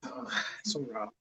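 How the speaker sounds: very soft, slow sarcasm; sarcastic. Real, not acted. Friend teasing about naked witch dancing, sarcastic. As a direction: murmur it low in mock disapproval. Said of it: "I was being sarcastic".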